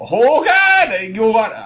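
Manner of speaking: high, loud attempt at a German accent. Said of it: "but with the German accent".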